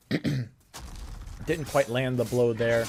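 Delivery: hoarse, sore throat voice; talking through a sore throat. Real, not acted. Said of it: "if I sound like I have a sore throat".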